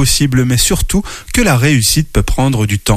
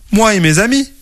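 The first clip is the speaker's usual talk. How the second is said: high little voice, proud; small ant voice; 8 semitones higher than usual. Acted. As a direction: speak like a tiny ant. Tiny ant answers. (petite voix aiguë de fourmi)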